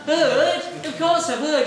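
mock-indignant answer; indignant retort. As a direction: bristle at the silly question. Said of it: "He says".

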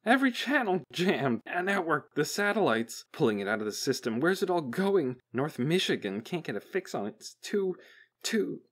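high-pitched, harried report; alarmed technician. A narrator's reading. Technician on the jammed network, alarmed and overwhelmed.

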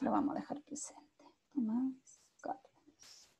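barely voiced, as if noting it down; murmured to self. Real, not acted. Noting a student's attendance to herself.

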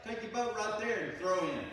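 calling out loud and high from the shore. Retold or imitated. Stranger on the shore calls out loud and high to the fishermen. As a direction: shout it across the water to the boat. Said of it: "a guy from the shore says".

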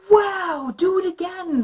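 high, loud wow; excited princess. Acted. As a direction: cheer him on. Princess eggs dragon on.

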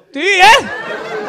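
high, loud laughing disbelief. Real, not acted. Teasing a stranger, laughing in disbelief. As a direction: laugh it out in mock surprise.

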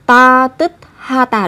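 "ta tức ha tà" slowly, syllable by syllable; unlinked pronunciation. Shown as a demonstration. Teacher shows the phrase before linking, unlinked "ta tức ha tà". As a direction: keep "tức" and "ha" apart, t closed off before h. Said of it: "Không phải là ta tức ha tà".

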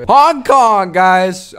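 high, loud announcement; gleeful high-pitched holler; excited shout. Pretend. Shouting out a joke answer.

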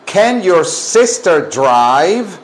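slow, emphatic question for the class to copy; slow teacher model. Shown as a demonstration. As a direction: space out each word clearly. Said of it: "Ahora pregunten". (pregunta modelo, lenta y clara)

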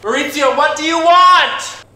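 high, loud exasperated yell; exasperated shout. Acted. Photographer yelling at the pasta boss, exasperated. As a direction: finally snap at him in a shout. Photographer loses patience with Maurizio.

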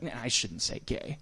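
a hushed retold backpedal; quiet. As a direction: mimic him saying it under his breath. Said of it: "I heard him very quietly go".

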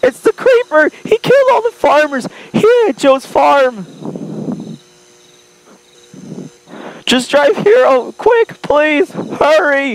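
high-pitched panicked plea; frantic pleading. Acted. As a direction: beg for help, terrified.

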